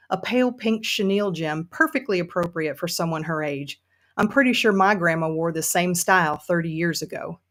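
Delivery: put-on storytelling in an amateur Southern accent. Frustrated Sue narrates from her basement, Southern accent. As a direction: read it as a frustrated Southern woman. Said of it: "I'm gonna do this in a Southern accent".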